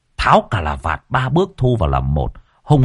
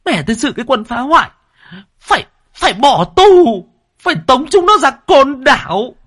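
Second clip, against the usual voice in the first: very high-pitched indignant ranting; high, loud, furious woman's complaint; outraged wife; 4 dB louder than usual. A narrator's reading. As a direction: rail shrilly at the wreckers, demanding punishment. Red-faced wife demands punishment.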